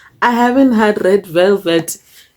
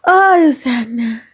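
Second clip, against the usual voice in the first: delighted sigh; 7 semitones higher, slower than usual. Real, not acted. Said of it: "Ha ke re".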